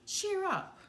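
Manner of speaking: high, bright mouse voice; cheering up. A narrator's reading. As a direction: coax a sad friend gently. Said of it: "Timothy comforting Dumbo".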